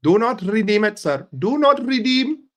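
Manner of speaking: loud, high put-on Indian accent. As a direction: insist in a hammed-up Indian accent. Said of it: "you'll think I'm fucking Indian".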